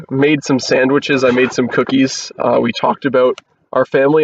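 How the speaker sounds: ordinary talk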